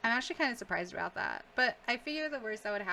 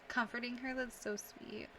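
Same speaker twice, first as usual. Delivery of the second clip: ordinary talk, nothing marked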